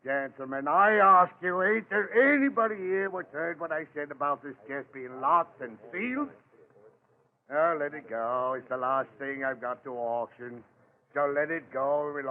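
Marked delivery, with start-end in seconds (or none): none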